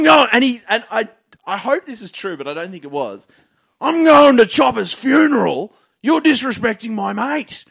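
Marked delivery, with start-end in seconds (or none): 3.8-7.6 high, loud and slow, outraged yelling; furious fan. Retold or imitated. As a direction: bellow it like a drunk mourner itching to swing. Punter throws punch at Subby.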